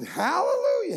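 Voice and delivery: hallelujah flung out high and joyful; shouted hallelujah. Retold or imitated. Caller finally hears the well digger speak a sentence, shouted hallelujah. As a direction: cry out hallelujah in mock relief. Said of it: "I just shouted".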